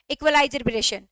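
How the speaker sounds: normal talking voice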